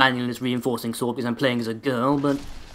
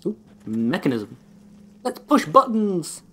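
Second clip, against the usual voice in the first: playful; 5 semitones higher, slower than usual. Pretend. Playful at a mechanism.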